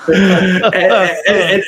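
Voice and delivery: sheepish high laugh through the words; embarrassed laugh. Real, not acted. Dad embarrassed by son's lesson.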